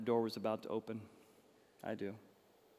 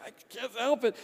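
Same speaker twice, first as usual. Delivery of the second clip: high, loud mock blubbering; helpless crying groom; 12 semitones higher, 9 dB louder than usual. Groom answering his bride at the altar, high loud mock wail. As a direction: wail it like a groom who cannot stop. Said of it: "And I'm like".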